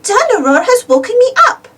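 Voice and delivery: put-on high, loud whiny little girl; Peppa complaining. A narrator's reading. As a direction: complain like a woken child.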